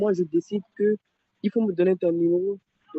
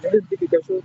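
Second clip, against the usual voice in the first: higher and louder, insisting; louder repeat; 13 semitones higher, 4 dB louder, faster than usual. Real, not acted. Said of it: "je t'ai dit".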